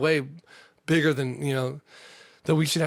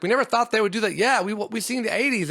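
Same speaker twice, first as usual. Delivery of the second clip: mock people quote; 8 semitones higher than usual. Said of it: "People are like".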